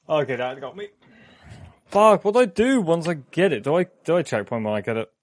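jittery, rattled after jump scares; scared horror game streamer. Real, not acted. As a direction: play it shaken by the scare.

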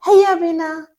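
ordinary talk, nothing marked

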